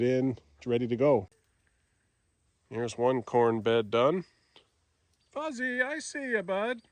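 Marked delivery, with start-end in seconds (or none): 5.3-6.8 high, soft pet-talk voice; talking to a dog. Real, not acted.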